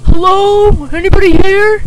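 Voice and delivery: imitating a loud, high call through the house. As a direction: call out like someone arriving upstairs. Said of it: "as he's coming up the stairs".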